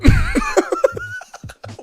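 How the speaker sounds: laughing high and breathless; high squeaky laugh. Real, not acted. Streamer cracking up after retelling the chase, high laugh. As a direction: burst into a high giggle.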